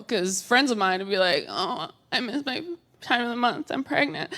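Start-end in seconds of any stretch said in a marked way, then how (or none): 1.5-4.3 soft, small confiding voice; imitated worried friend. Friend confiding a pregnancy scare, soft imitation. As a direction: confide it softly, as a friend would.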